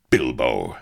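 low, loud exclamation of recognition; sharp, falling outburst; cracking voice. A narrator's reading. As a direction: bark the name in shock. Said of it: "The captain's voice cracked like a fractured walnut".

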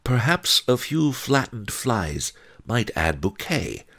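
high, musing character voice; thoughtful. A narrator's reading. One-Eyed Wong pondering flies for the wine, thoughtful. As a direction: muse aloud as if testing a recipe. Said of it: "One-Eyed Wong said thoughtfully".

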